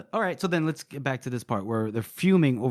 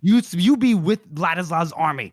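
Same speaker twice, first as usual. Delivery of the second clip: loud, raised mock threat; angry dressing-down; 4 semitones higher, 5 dB louder than usual. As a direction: hammer it home as an irate superior.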